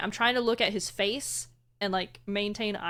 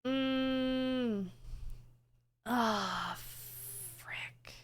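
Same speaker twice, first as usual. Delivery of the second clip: choked up, voice going quiet; fighting back tears; about to cry; 3 semitones higher, 3 dB quieter, much slower than usual. Real, not acted. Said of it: "I'm gonna cry".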